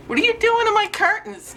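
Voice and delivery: very high, exasperated pet voice; scolding a pet. Real, not acted. Owner to cat on expensive curtains, high scolding. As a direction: demand it of the cat, high-pitched.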